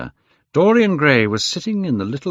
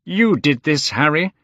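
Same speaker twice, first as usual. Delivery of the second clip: higher-pitched, indignant; angry accusation; 6 semitones higher, 3 dB louder than usual. A narrator's reading. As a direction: accuse your friend angrily. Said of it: "said the painter angrily".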